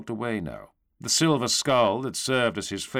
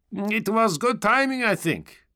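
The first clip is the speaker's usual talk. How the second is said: high-pitched, chatty trooper voice; easygoing stormtrooper; 12 semitones higher, 3 dB louder than usual. A narrator's reading. Stormtrooper talking to towering knights, high easygoing voice.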